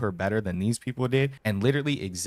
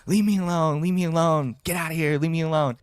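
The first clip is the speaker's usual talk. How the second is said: put-on upset girlfriend; 7 semitones higher than usual. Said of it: "And she's like".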